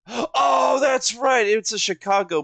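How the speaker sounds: gasp, then a high, loud "oh, that's right"; real shout of recognition after a sharp gasp; sudden realization. Real, not acted. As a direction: gasp in real surprise and blurt it out. Suddenly realizes it's Chicago.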